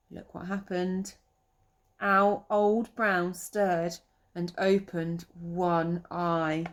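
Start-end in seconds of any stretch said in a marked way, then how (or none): none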